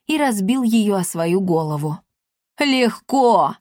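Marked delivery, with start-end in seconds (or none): 2.6-3.6 cocky challenge; defiant. A narrator's reading. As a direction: toss it back daringly. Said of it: "с вызовом бросил Полуфаза".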